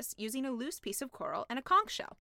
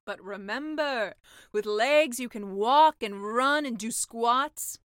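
slow, louder tempting pitch; selling sea witch; 9 dB louder, slower than usual. Acted. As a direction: sell the perks slowly. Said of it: "said the sea witch".

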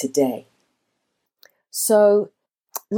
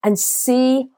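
raised, uplifting coach's voice inviting the audience to dream; motivational speech; 4 semitones higher than usual. Acted. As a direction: lift them up and paint the vision. Motivational visualization address.

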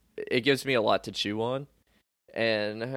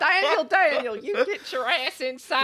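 much higher calling-out mom voice; yelling Southern mom. Retold or imitated. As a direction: holler him inside like a mom on the porch. Mom calling son inside.

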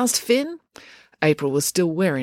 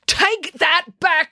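much higher, slow demand; girl choking a boy, higher and slow; crying out; 13 semitones higher, much slower than usual. Acted. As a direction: drag out the furious demand. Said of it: "cried April".

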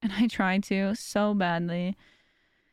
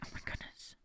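barely audible whisper to self; exasperated aside; 19 dB quieter than usual. Retold or imitated. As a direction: whisper it under your breath in disbelief. Exasperated aside about spoiling grandma.

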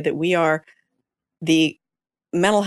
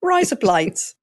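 higher put-on Aussie vowels; Australian accent; 4 semitones higher, slower than usual. Running joke after the laughter, Australian accent. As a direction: repeat the gag in an Australian accent. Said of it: "say in an Australian accent".

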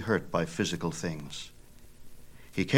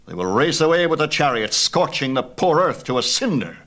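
the angry god, much higher and louder, rising to a peak on the stronger lines; roar of anger; 4 semitones higher, 5 dB louder than usual. A narrator's reading. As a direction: roar the warning at the rash son. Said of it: "Apollo's roar of anger".

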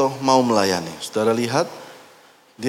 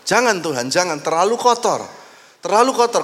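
higher, rising pleading protest; pleading disciple; 6 semitones higher than usual. Acted. Disciple refusing to let Jesus wash his feet, pleading. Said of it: "dia bilang".

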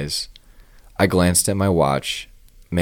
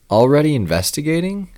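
smiling; 10 semitones higher, 7 dB louder, faster than usual. A narrator's reading. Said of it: "I asked with a smile".

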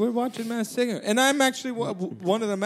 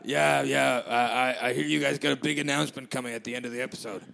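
much lower, gruff put-on man's voice; Rupert voice; 8 semitones lower than usual. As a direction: drop low and play Rupert on the phone. [name] holding Rupert voice.

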